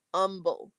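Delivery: "umble" with the opening H dropped; H-dropping. Shown as a demonstration. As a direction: say "umble" starting straight on the vowel, no H. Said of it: "H dropping, a stereotype".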